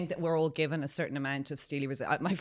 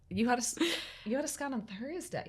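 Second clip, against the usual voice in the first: mock exasperated staff; 8 semitones higher, 4 dB quieter than usual. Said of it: "And they're like".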